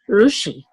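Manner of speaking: R in "rushi" rolled toward the roof of the mouth; demonstrating the rolled R of "rushi". Shown as a demonstration. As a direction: say "rushi" with the tongue off the teeth, rolling at the palate. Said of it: "but it rolls, touch the roof".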